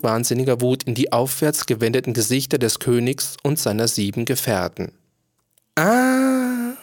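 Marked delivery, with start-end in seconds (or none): 5.8-6.7 very high, drawn-out "ah"; jester's exclamation. A narrator's reading. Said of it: "sagte der Spaßmacher". (hohes, gedehntes Ah des Spaßmachers)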